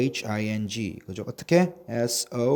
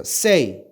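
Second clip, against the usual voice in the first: "say" louder and higher; a raised, emphatic "say"; model word to repeat; 4 semitones higher, 6 dB louder than usual. Shown as a demonstration. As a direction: present "say" clearly for learners to echo. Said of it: "따라해보세요".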